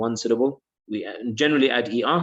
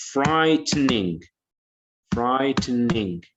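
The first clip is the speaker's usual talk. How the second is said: slow syllable count; much slower than usual. Shown as a demonstration. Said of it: "How many syllables?".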